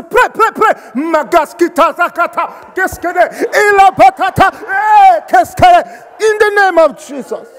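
high, explosive imitation of fervent praying; mock African church prayer, shouted; vehement prayer shouting. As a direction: fire out the syllables at full force. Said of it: "with so much vehemence and force".

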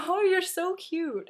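loud, high, drawn-out mock cooing; gushing. Vlogger imitates party guests reacting to her German, exaggerated gushing. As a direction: gush over someone adorable, hammed up.

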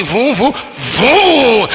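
playful vroom imitation; car engine noise.

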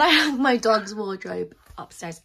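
proudly projected, pitch arching then falling; emphatic announcement. Real, not acted. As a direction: show it off emphatically. First take, interrupted by excuse me.

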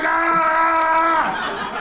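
long, high mock scream; pained scream. Nun as her arm is twisted, pained scream. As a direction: hold a high cry of pain.

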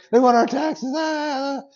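high, fretting voice as Peter; mock panic over the taxes; worried Peter. Pastor voicing Peter in a high fret. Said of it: "Remember that story?".

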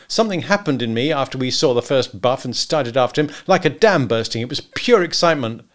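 building excitement, pitch climbing; Macumber, excited. Acted. Husband describes his change to hunter, excited. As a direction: confide it with growing exhilaration.

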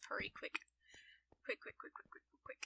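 rapid, hushed repetition under the breath; hurried muttering. Real, not acted. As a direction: rush the word out quietly, over and over. Rushing to light cave before spawns.

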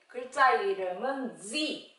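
usual speaking voice

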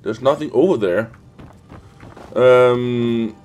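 usual speaking voice

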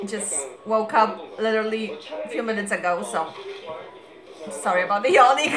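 ordinary talk

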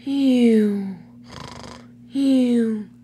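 loud, high drawn-out "eww" noise; weird sleeping-diesel sound. A narrator's reading. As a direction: imitate a sleeping diesel's high, sliding whine. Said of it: "made a weird sound".

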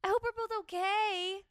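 high, drawn-out mock lament; hammed-up heartbroken whine; mock wailing. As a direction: wail it like it's unbearably sad. Mock-wailing the quoted breakup line.

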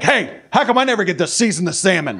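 high, grumpy picking a fight; petty grumble over salmon; mock angry. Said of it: "all angry for no reason".

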